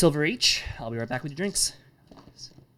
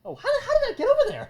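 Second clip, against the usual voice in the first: squeaky mock surprise; 3 dB louder, faster than usual. Real, not acted. GM jokes about a die roll.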